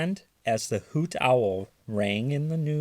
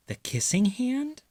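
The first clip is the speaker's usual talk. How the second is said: high, wondering question; the small one echoing, amazed; surprised little raccoon; 14 semitones higher than usual. A narrator's reading. Chester asking his mother about the kissing hand, high and wondering. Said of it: "asked Chester".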